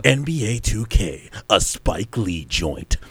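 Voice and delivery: very low, slow tagline voice; mock announcer. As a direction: drop deep and drag out the tagline like a promo. Hammed-up game marketing tagline.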